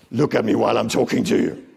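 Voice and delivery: loud, stern scolding voice; stern demand. Acted. Trainer shows staff what never to say, loud stern demand. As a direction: demand attention like a cross adult. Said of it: "So you never say".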